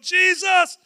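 high, loud, drawn-out shout; calling out. Acted. Two blind men shouting to the passing Jesus, calling out. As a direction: holler for help down the street. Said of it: "two blind men are standing over here going".

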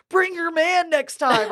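high, slow mocking call; imitated taunt. Girl's boyfriend standing there taunting, high drawn-out mock call. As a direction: drag it out as a useless bystander's taunt.

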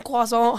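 "croissant" as kwah-SOH; mock-French "croissant", kwah-soh; French-style croissant. Shown as a demonstration. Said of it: "however you wanna say it".

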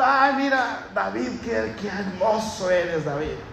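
lightly mock cooing praise at David; mock sweet flatterer. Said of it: "Entonces somos un amor".

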